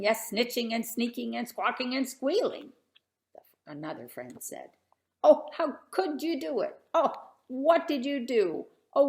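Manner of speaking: lively sing-song storybook reading; rhythmic picture book read-aloud; reading a rhyming story aloud. Acted. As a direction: read the verses to a room of kids.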